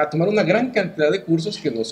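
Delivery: ordinary talk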